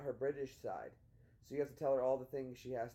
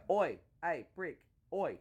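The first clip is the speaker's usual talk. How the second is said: loud, higher British "Oi!"; mock British heckle; 4 semitones higher, 5 dB louder, slower than usual. Teaching her British insults, mock British heckling. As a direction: call out like a mock British lout.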